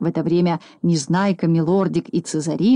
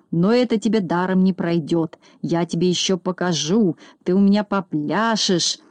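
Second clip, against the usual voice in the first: usual speaking voice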